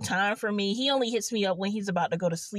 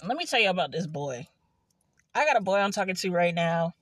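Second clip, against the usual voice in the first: usual speaking voice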